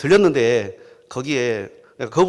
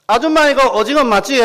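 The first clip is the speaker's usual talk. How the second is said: Gyeongsang lady; 9 semitones higher, 6 dB louder than usual. Acted. Gyeongsang woman asks squid seller.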